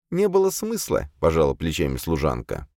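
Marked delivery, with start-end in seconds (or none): 0.1-1.1 high female voice with a verbal shrug; resigned servant demoness. A narrator's reading. Servant shrugs off the exorcist's remark, high and resigned. Azalia's voice in story.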